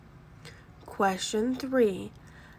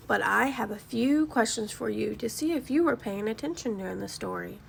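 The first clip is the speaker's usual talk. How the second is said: plain reading voice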